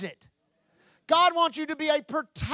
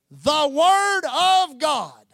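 very slow, loud, each word stretched out; grand proclamation; 2 semitones higher, 5 dB louder, much slower than usual. Real, not acted. Preacher revealing the words on Jesus' sash, grand slow proclamation. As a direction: proclaim it like a banner unveiled.

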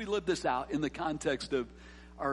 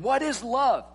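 preaching climax; 9 semitones higher, 8 dB louder, much slower than usual. Real, not acted.

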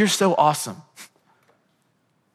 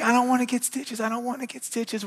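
put-on much higher whiny child voice; scared little daughter; 10 semitones higher, 4 dB quieter than usual. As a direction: whimper like a frightened kid. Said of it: "She's like".

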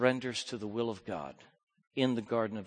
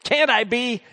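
raised, loud pleading question; pleading Jesus; 9 semitones higher, 11 dB louder than usual. Acted. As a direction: plead for another way. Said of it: "the old temptation voiced".

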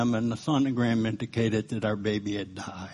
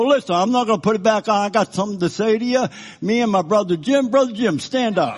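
bold preacher; 8 semitones higher, 6 dB louder, faster than usual. Retold or imitated. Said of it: "he would go".

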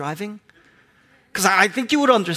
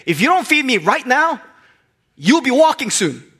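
mock threat; 6 semitones higher, 3 dB louder than usual. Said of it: "Now it's a threat".

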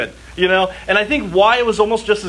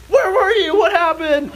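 lightly voiced worried parents, pitched up high; a hint of frantic parents; 10 semitones higher than usual. Parents finding lost child.

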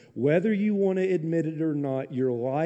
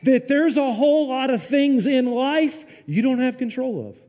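much higher and louder, emphatic; preaching shout; 10 semitones higher, 6 dB louder than usual. Real, not acted. Pastor shouting to the congregation, much higher and louder. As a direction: call it out to the whole room.

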